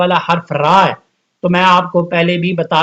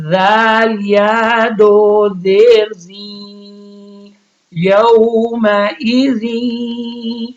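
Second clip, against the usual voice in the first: slow, sung Quran spelling chant; slow Quranic recitation, letter by letter; recitation drill; 3 semitones higher, much slower than usual. Shown as a demonstration. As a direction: spell and chant each syllable slowly for the class.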